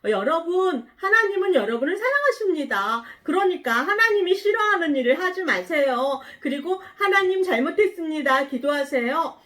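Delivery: prophet's proclamation. Acted. God's message for the people of Nineveh, loud proclamation. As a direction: call out to a whole crowd. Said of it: "그 사람들에게 가서".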